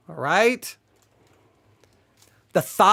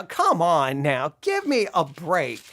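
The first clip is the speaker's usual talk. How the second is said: ordinary talk, nothing marked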